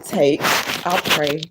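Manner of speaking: loud, measured Bible reading; emphatic read-aloud of scripture. Acted. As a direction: read the verses out firmly, phrase by phrase with pauses. Reads scripture aloud to viewers.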